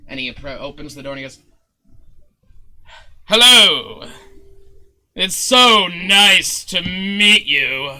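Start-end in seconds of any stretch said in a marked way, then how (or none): 3.2-8.0 loud, drawn-out theatrical welcome; effusive showman Zin. Acted. Showman greeting Zonk at his door, effusive. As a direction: gush a grand, booming greeting.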